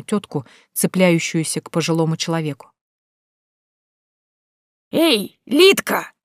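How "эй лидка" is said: put-on high, slow, teasing call of an old woman; much higher, slow mocking old crone; mocking old woman's call. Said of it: "насмешливый, дребезжащий голос".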